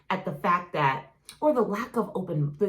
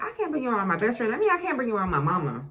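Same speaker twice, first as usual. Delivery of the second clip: quick, tumbling retold thought; fast half-joking brush-off; rattled off quote; 4 semitones higher, faster than usual. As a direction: rush through it like you're panicking. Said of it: "I was like".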